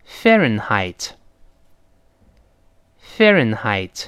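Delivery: slow, clear "Fahrenheit", falling at the end; read-aloud model. Shown as a demonstration. Teacher leads students reading aloud, clear model pronunciation. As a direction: pronounce "Fahrenheit" deliberately for repetition. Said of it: "跟老师大声读一遍".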